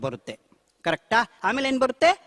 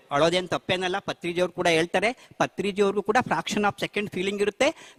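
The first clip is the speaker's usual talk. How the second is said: normal talking voice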